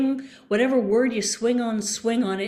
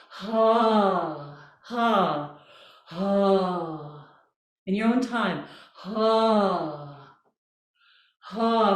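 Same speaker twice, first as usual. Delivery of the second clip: yawny ha; 3 semitones lower, much slower than usual. Shown as a demonstration. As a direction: open yawning ha on the breath. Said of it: "Let's do some yawny vowels".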